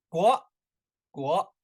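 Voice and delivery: 国 as a rising second-tone model. Shown as a demonstration. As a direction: say 国 with the pitch going up. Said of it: "second tone".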